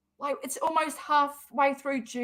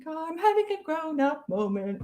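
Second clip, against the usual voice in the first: a light, higher mock-proud voice; a hint of a kid showing off; 6 semitones higher than usual. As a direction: play it as proudly pleased with yourself. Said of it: "I'm like".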